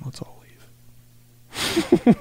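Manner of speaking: slow plotting whisper; conspiratorial whisper. Retold or imitated. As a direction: whisper the plan so he can't hear. Friends plotting behind his back.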